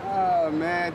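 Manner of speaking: ordinary talk, nothing marked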